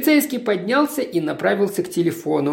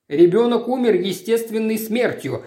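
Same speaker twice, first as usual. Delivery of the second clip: usual speaking voice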